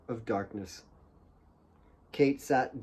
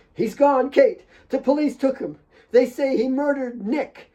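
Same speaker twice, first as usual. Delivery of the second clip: raised high yelling; shouting; 10 semitones higher than usual. A narrator's reading. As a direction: shout to get through to her. Jamina shouting over the struggle.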